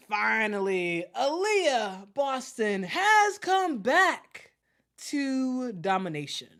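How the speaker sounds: drawn-out shouted wrestling catchphrase; The Rock. Acted. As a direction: hype her comeback like a ring announcer. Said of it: "the first thing I think of".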